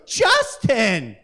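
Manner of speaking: high, shocked scolding mother, slowing on the last words; scolding mom. Retold or imitated. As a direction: play the mom who is scandalised by swearing. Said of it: "my mom goes".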